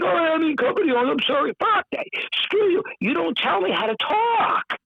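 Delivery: high, whiny cave-in; mock meek apology. Mocking meek people apologising to a CEO, high and whiny. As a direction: lampoon people who back down. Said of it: "Nine point five out of ten people are like".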